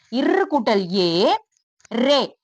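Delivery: இர் கூட்டல் ஏ drawn out slowly, syllable by syllable; slow spelling out. Shown as a demonstration. Teacher spelling out a letter slowly for young pupils. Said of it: "slow spelling-out demo".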